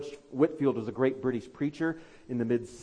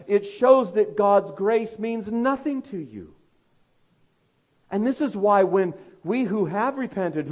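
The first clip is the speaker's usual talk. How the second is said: higher and louder preaching, slowing hard on the last line; fiery preaching; 6 semitones higher, 7 dB louder than usual. Acted. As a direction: press the warning home from the pulpit. Sermon at full force.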